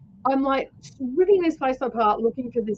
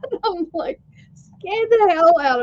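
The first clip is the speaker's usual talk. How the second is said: laughing; 9 semitones higher, 6 dB louder, slower than usual. Real, not acted. Laughing recalling the fright.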